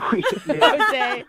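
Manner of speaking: slow, high, laughing it out. Real, not acted. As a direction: giggle through the words. Repeats confession through laughter.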